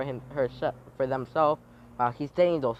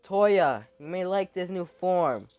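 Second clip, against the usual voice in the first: loud call; 6 semitones higher, 7 dB louder than usual. Acted. Character calls out to Toya.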